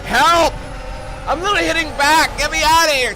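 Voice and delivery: real panicked yells, high and loud; panicked shouting. Real, not acted. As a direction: yell for help as the car spins. Car out of control in game.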